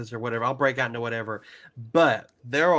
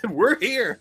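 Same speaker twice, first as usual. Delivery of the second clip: excited; 9 semitones higher, 4 dB louder than usual. Real, not acted. Finally recording the long-planned episode.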